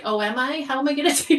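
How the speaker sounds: high, loud, laughing mock panic; laughing self-quote. Reader imagining being told to build a bomb, laughing disbelief. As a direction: laugh through it, flustered and unsure. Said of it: "And I'd be like".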